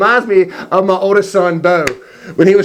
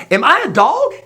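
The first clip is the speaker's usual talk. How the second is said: raised-pitch scornful question; taunting giant; 7 semitones higher than usual. Acted. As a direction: play Goliath mocking a small challenger. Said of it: "Goliath says".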